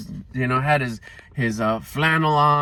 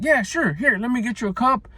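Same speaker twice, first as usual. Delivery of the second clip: much higher, bright welcoming manager voice; higher, cheerful host voice; friendly manager; 10 semitones higher than usual. Retold or imitated. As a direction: play the kind boss offering a drink. Imitating the manager's reply.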